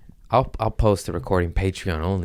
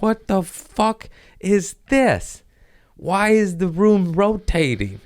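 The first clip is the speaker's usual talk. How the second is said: much higher, slow panicked cry; mock panic from a dizzy spell in the shower; 11 semitones higher, 4 dB louder, slower than usual. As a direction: panic as the room spins. Retelling vertigo panic.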